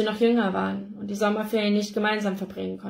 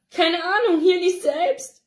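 mother higher and louder, slow; upset mother; 10 semitones higher, 5 dB louder, slower than usual. A narrator's reading. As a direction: hand it over helplessly. Ella, high and slow.

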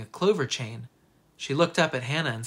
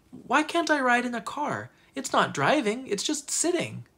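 high-pitched, whiny-edged argument; narrated child's protest; 9 semitones higher than usual. A narrator's reading. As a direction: argue it in a raised child's pitch. Child argues with her parents.

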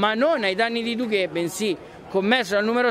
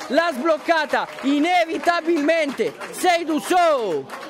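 goal commentary shout; 9 semitones higher, slower than usual. Real, not acted. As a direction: erupt for the opening goal. Goal scored, commentator erupts.

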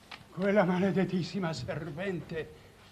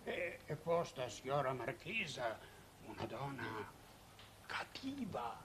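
hushed, much softer warning; fearful confiding; 8 semitones lower, 13 dB quieter than usual. Acted. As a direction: drop the voice as if she might hear. Fearful confiding about the marchesa.

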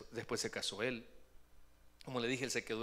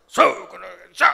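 loud raging yell, much higher; furious shout; 5 semitones higher, 22 dB louder, slower than usual. Retold or imitated. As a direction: bellow in a rage. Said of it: "el tablero". (grito furioso, mucho más alto y fuerte)

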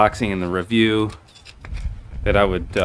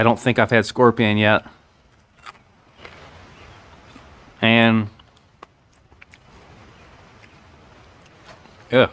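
biting into crunchy food; talking while chewing. Real, not acted. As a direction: mouth partly full. Seen 0: bites scorpion at 0.2–2.0s, then keeps chewing, mouth closed.

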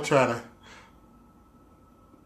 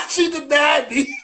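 high, laughing cry of mock outrage; laughing outcry; 13 semitones higher, slower than usual. Real, not acted. Streamer tells chat the call got declined, laughing outcry. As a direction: yelp it through laughter, tickled she hung up.

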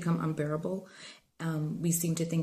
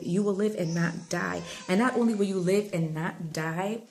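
rising, louder preacher's build to a peak; preaching; 4 dB louder than usual. Acted. Preacher urging viewers to trust God, building fervent delivery. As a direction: lift the church with each promise, louder.

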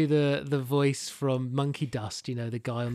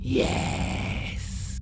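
unvoiced, drawn-out rasping yes; sinister whispered answer; whispered villain. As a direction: whisper it like a lurking killer. Villain's part in script.

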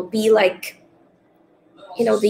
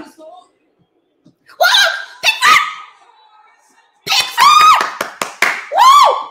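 much higher and louder yells of joy; ecstatic shouting; 6 dB louder, much slower than usual. Real, not acted. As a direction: scream the keeper's name in disbelief. Keeper saves the penalty live.

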